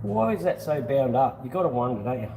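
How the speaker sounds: ordinary talk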